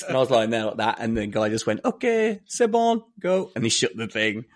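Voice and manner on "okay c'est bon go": higher, louder mock French accent; bad French accent. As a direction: imitate a French border guard's accent. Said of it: "I'm gonna do a bad accent now".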